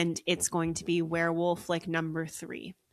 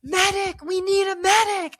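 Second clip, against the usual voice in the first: high, loud imitated cry for help; top-of-lungs yell; 12 semitones higher, 8 dB louder than usual. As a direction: yell out as if summoning urgent aid. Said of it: "yelling at the top of their lungs".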